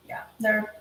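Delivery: ordinary talk, nothing marked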